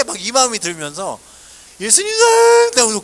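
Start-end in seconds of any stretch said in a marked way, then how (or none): 1.8-3.0 high, loud sobbing declaration; tearful cry. Retold or imitated. Boy praying alone in church, crying out his love through sobs. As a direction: cry out the words through tears. 울다가 오고.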